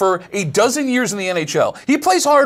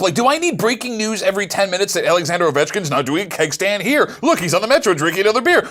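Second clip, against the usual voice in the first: usual speaking voice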